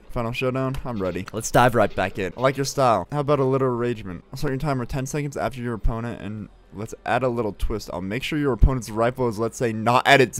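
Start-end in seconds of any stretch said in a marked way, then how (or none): none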